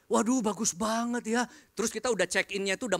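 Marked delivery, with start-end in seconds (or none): 0.1-1.4 higher, wide-eyed voice of the impressed driver; lightly voiced driver, amazed; awed driver. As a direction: gasp at the fancy hotel room. Driver admiring hotel room.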